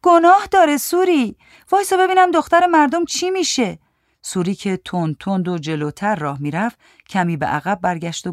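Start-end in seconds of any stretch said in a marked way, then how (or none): 0.0-3.8 high, pleading call. A narrator's reading. Giti asks Souri to wait for the girl, calling out. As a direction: call after someone walking away. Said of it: "صدا زد".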